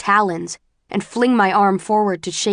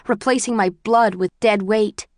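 ordinary delivery, nothing marked

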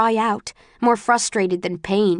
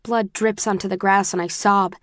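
ordinary delivery

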